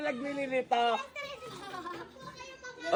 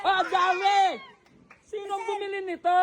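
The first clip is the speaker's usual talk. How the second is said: surprised; 7 semitones higher than usual. Real, not acted. Sees a pile of gifts.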